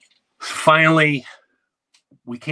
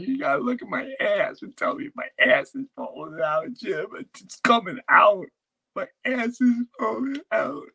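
high, strained shouting wife; shrill panicked wife crying about her back; wife in labor; 14 semitones higher than usual. Retold or imitated. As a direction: yell it like a wife in labor pain. Wife in labor, voiced by comic.

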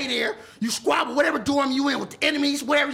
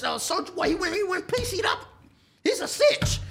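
higher, rising to a jeering call; Snap's voice; 8 semitones higher than usual. Retold or imitated. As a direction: do the homie's voice, calling him out. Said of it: "in my baby Snap's voice".

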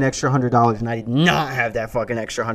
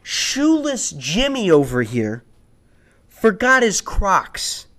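snide request; 7 semitones higher, 3 dB louder than usual. Retold or imitated. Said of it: "I had to be a dick about it".

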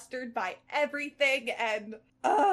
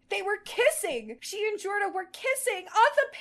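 higher, astonished exclamation; shocked; 8 semitones higher than usual. Acted. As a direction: gasp it out in disbelief. Heroine shocked by the kiss.

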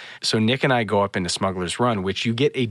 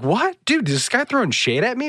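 high-pitched retold disbelief; incredulous. Guest teased by a smuggler, comically incredulous. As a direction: act out being taken aback by an insult.

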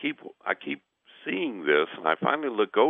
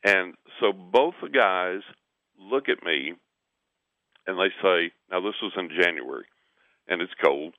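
ordinary talk

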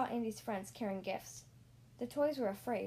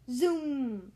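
louder whooshing car noise; zooming car sound; 2 semitones higher, 7 dB louder than usual. Acted. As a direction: make the car zoom past. Toy car shoots forward.

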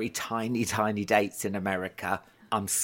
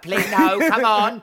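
higher and louder, pleading through a laugh; laughing plea; 9 semitones higher, 9 dB louder than usual. Retold or imitated. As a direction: beg them to sit down, half laughing. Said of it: "I'd be like".

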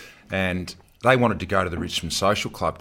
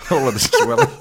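laughing; 6 dB louder than usual. Retold or imitated. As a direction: quote breaking into laughter. Laughing while quoting the trainer.

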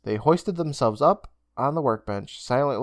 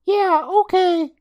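put-on high boyish voice, slow and grudging; reluctant boy; 5 dB louder, much slower than usual. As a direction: drag it out unwillingly, kid voice. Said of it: "Day Day said reluctantly".

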